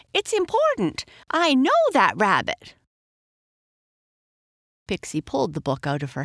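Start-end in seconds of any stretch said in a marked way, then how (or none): 0.1-2.7 high, urgent insistence, louder at the end; demanding. A narrator's reading. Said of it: "the girl demanded".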